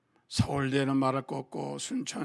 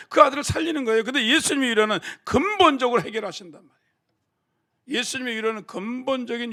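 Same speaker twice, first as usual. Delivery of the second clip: loud, higher preaching, pressing the point; full-force preaching; 5 semitones higher, 8 dB louder than usual. Acted. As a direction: preach it out to the whole congregation.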